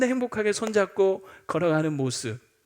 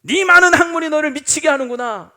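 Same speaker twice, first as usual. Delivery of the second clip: Festus shouting, much higher and louder; angry shout; 8 semitones higher, 11 dB louder than usual. Acted. Roman governor yelling at Paul, furious shout. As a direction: bellow at Paul that he's mad. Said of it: "갑자기 소리를 지르면서".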